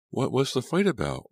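high-pitched, insistent question; demanding. A narrator's reading. Laura asking Martha about the fight, demanding. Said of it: "Laura demanded".

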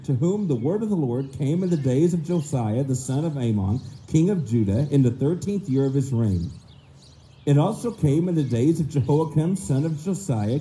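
ordinary talk

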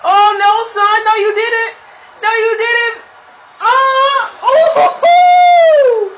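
sudden high shouting outburst; excited shout. Real, not acted. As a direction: yell out in shock at the play. Big play against him, yelling.

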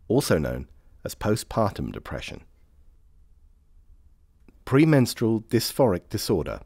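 ordinary talk

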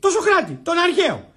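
high-pitched, emphatic correction; indignant. Acted. Prisoner reminds her of the ancient philosopher, indignant. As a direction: correct her as if it were obvious. Indignantly explains which Socrates.

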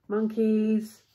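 ordinary delivery, nothing marked

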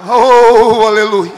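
loud, slow, soaring preacher's cry with each line hammered; preaching at full force. Real, not acted. Pastor crying out to the congregation, preaching at full force. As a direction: drive every phrase home like a fiery revival preacher.

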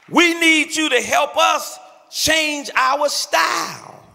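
rising, slowed preacher's plea; preaching. Acted. Pastor appealing to the young people of his church, earnest preaching. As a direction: lean in and plead from the pulpit.

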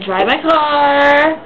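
drawn-out complaint. Retold or imitated.

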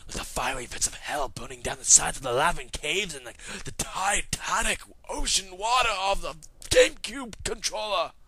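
hammed-up, swelling tirade; Will Ferrell. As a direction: build a mock-epic rant, rising toward the end. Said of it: "I just don't like when he does that".

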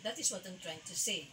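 usual speaking voice

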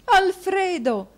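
high, drawn-out anguished call; a wife clinging to her husband's neck; desperate cry. A narrator's reading. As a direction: throw the name at him in fear. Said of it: "esclamò la moglie gettandogli le braccia".

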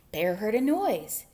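ordinary delivery, nothing marked

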